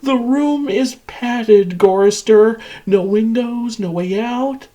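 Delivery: high singsong cruelty; gleeful taunting. Acted. Machine describes wife's padded room to captive, gleeful taunt. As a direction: draw it out high, relishing the torment.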